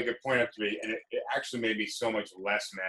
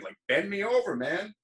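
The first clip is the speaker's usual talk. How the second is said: mock surrender; 9 semitones higher, 4 dB louder than usual. Mocking surrender to angry cop.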